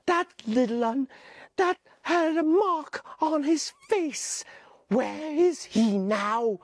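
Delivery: Piggy asking again, slowing down; a hint of Piggy, anxious; lightly voiced Piggy.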